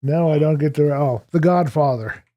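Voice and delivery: usual speaking voice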